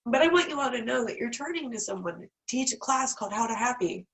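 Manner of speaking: ordinary talk, nothing marked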